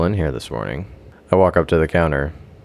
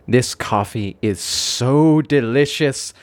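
sinister realization; 12 semitones higher, slower than usual. A narrator's reading. Ominous realisation, ending twist.

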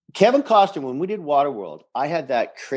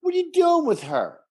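high-pitched, affronted protest; hammed-up incredulous complaint; mock indignation; 13 semitones higher than usual. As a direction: play the slighted boss demanding an explanation. Acting out affronted club president.

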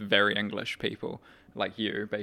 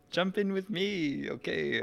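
put-on slow, high Spanish-accented voice; lightly imitating Fernando Alonso; Alonso impression; 8 semitones higher, slower than usual. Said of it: "Great impression, by the way".

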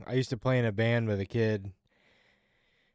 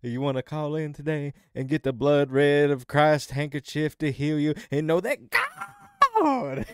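infomercial preacher; 5 semitones higher, 4 dB louder than usual. Retold or imitated. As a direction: stretch out "God" high and long. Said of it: "Every time he said God, he said it like that".

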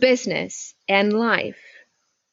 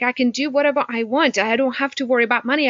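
normal talking voice

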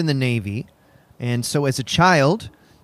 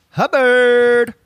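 dramatic reveal; 8 semitones higher, 6 dB louder than usual. Acted. Big name reveal.

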